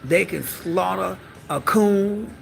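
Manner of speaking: ordinary delivery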